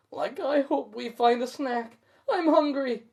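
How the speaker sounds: high, hungry Shaggy whine. A narrator's reading. Shaggy telling Scooby he is hungry, high voice. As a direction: sound starving and hopeful. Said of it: "Shaggy says".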